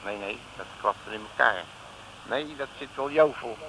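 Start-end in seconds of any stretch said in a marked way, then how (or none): none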